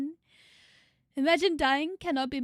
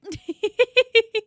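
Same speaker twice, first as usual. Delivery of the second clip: a loud, higher burst of laughter; 9 semitones higher, 11 dB louder than usual. Real, not acted. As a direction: crack up at your own line. Laughs after quoting I'm back.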